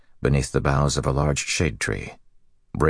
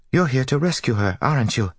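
put-on higher, eager girl voice; 11 semitones higher than usual. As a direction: ask it eagerly, hopeful. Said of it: "The novice sounded eager".

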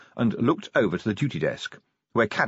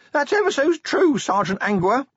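very high, louder, strangled panic; terrified squeak; 4 dB louder than usual. A narrator's reading. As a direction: squeak it out desperately. Pinned watchman squeaks answers.

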